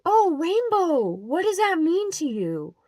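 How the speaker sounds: high, slow, sugary mock question; mock sweet churchy lady. Vlogger mocks the church woman's question, high and sugary.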